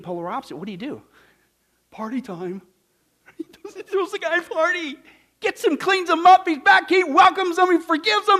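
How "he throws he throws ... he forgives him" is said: high, loud celebration; preaching at full force, elated; excited. Real, not acted. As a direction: shout the joy of the homecoming.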